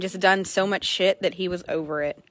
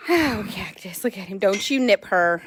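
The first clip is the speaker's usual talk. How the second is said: ordinary talk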